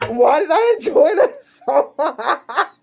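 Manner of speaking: high, slow words dissolving into laughter; real laughing fit through the question; laughing helplessly. Real, not acted. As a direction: ask it while cracking up. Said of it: "Why am I laughing so much?".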